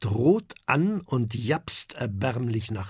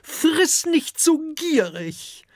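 much higher, slow chiding mother voice; dragon mother scolding; 14 semitones higher, slower than usual. A narrator's reading. Dragon mother tells her husband off, high and chiding. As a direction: scold him gently from up high. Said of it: "sagt eine Fressdrachin".

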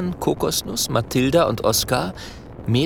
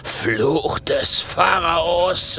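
slow, scornful laughing robber; mocking laughter; 4 semitones higher, much slower than usual. A narrator's reading. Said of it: "bricht in ein hämisches Gelächter aus".

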